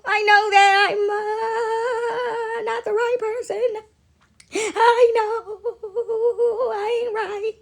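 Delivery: high, slow tearful plea; plead, voice near tears; pleading cry. Retold or imitated. Pleading apologetic person voiced.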